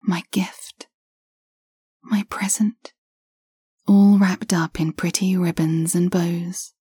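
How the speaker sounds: plain reading voice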